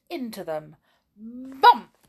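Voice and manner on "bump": loud. A narrator's reading. Bear walks into them.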